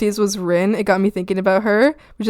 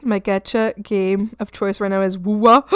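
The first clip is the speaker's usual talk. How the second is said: ordinary talk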